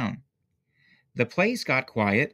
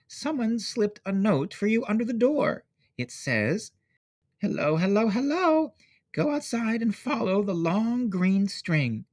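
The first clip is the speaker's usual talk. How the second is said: mother; 10 semitones higher than usual. A narrator's reading. Mother voice reading note.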